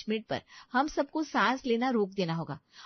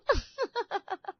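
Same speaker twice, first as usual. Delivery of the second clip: laugh; 11 semitones higher than usual. Acted. [name] laughing to herself.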